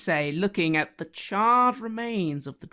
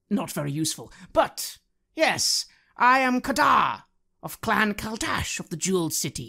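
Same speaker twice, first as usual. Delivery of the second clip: loud, grandiose self-praise; boastful Kadar; 4 dB louder than usual. Acted. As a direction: brag to impress the dragon. Player continues pompous character.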